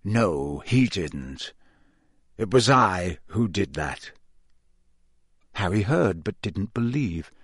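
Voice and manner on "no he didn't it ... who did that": a villain revealing himself, low and cold; chilling Moody. A narrator's reading. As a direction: let the confession sink in low.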